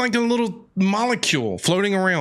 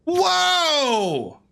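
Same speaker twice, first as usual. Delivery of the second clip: high, drawn-out gasp of awe; amazed whoa; 6 semitones higher than usual. Real, not acted. Player reacts to the bard's tale with a stunned whoa.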